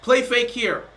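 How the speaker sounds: ordinary talk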